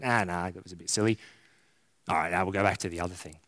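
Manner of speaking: low, fast, offhand band member; casual churchgoer. Retold or imitated. Voicing church members deciding casually.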